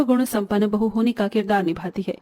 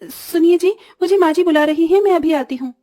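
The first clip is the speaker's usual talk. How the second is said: higher-pitched call to husband; 6 semitones higher, 4 dB louder than usual. Acted. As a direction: call out to your husband as you leave. Wife calls to husband while leaving. (ऊँचे सुर में पति को पुकारना)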